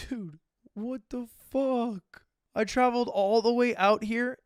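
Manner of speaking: slow, drawn-out exasperation; mock outrage. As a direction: stretch it like you can't believe it. DJs already finished, frustrated.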